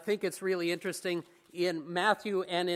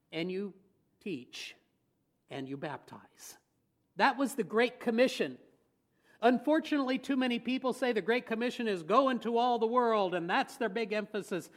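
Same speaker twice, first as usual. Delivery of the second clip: ordinary delivery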